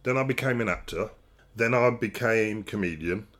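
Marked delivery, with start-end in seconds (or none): none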